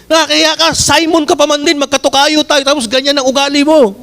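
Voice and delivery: high-pitched, mildly offended scolding; acted-out indignant rebuke, pitched well up; mock indignation. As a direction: tell someone off as if insulted. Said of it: "nagagalit eh".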